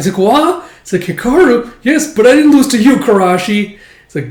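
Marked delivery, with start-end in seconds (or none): 0.2-0.7 high, loud rising question; mock outraged surprise. Rival reacting to a taunt, mock outraged. 1.0-3.8 high, loud squabbling; mock heated retort. Hikaru squabbling with rival Karashi, mock heated.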